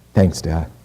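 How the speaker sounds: shy, downcast thank-you from the son; sheepish thanks. Retold or imitated. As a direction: say it sheepishly, eyes on the floor. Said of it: "Look down kinda sheepishly and go".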